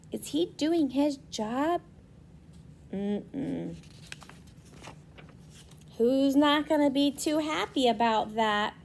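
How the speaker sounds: usual speaking voice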